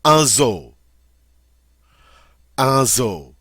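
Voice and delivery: teacher modelling "Un zoo"; French pronunciation. Shown as a demonstration. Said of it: "Notice the French pronunciation".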